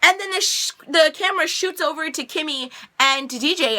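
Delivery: ordinary delivery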